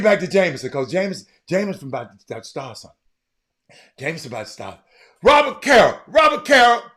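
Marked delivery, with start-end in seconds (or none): 5.2-6.8 loud call-out. Real, not acted. Calling out a viewer.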